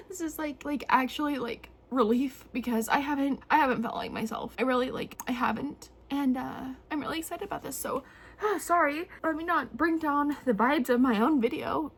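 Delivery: high voice fighting back tears; real tearful relief. Real, not acted. Vlogger apologizing for bringing down the vibes, tearful. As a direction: push on through real tears. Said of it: "this isn't even hee hee ha ha tears".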